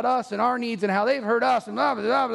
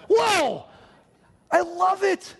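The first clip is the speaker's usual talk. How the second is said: excited whoa; 8 semitones higher, 4 dB louder, slower than usual. Real, not acted. Delight at church serving.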